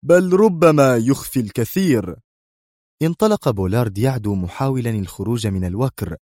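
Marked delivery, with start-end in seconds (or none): 0.1-2.2 raised, severe final verdict; intensely stern. A narrator's reading. Boss adds his last threat, very sternly. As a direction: bear down with doubled severity. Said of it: "ثم تضاعفت صرامته وهو يضيف".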